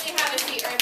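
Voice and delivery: ordinary talk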